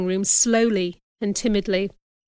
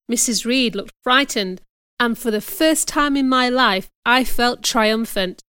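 ordinary delivery

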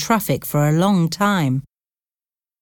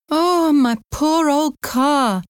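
higher, drawn-out Irish taxi driver; slow Irish lament, pitched up; Irish accent; 10 semitones higher, slower than usual. A narrator's reading. Taxi driver moaning over his broken-down car, in an Irish accent. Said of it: "the driver's Irish accent".

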